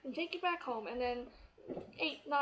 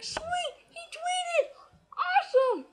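much higher, loud squeal of delight; thrilled shout; 8 dB louder, slower than usual. Retold or imitated. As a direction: shriek it out with joy. Thrilled a stranger tweeted about her.

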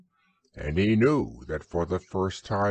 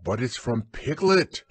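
raised, startled exclamation; surprised cry; 5 semitones higher, 3 dB louder than usual. A narrator's reading. Christopher Robin reading the message, crying out. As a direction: cry out on discovering who sent it. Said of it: "cried Christopher Robin when he had read it".

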